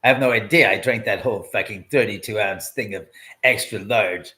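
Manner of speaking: ordinary delivery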